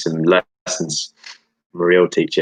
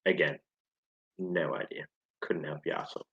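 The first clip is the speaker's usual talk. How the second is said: very soft, dropping almost to a whisper; muttered aside; 4 semitones lower, 15 dB quieter, faster than usual. Real, not acted. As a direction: murmur it as if to yourself. Quizmaster's aside on his own question.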